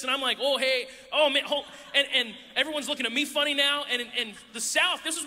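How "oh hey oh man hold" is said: put-on Wisconsin accent. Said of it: "I'm, like, used to this".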